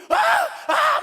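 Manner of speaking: high, loud mock cry of strain; retold scream. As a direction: let out a brother's straining yell. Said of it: "막 소리 지르더라고요". (껍질이 안 벗겨져 지르는 흉내 비명)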